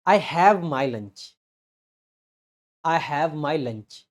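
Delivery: slow, deliberate "I have my lunch" with weight on "I"; slow model sentence. Shown as a demonstration. Teacher models the sentence for students, slow and deliberate. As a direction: spell out "I have my lunch" word by word. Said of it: "Have का मतलब यहां पे है कि मेरे पास lunch है".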